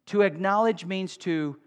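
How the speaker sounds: ordinary talk, nothing marked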